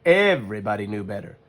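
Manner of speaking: ordinary delivery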